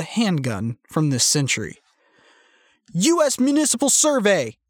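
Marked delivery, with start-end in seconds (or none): 2.9-4.6 high-pitched shouted announcement. A narrator's reading. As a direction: call it out high to an armed squad. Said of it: "he shouted".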